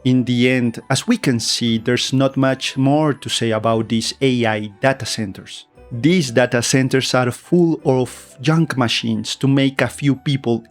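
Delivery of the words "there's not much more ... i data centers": usual speaking voice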